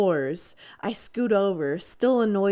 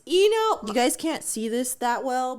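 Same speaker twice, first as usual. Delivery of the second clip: ordinary talk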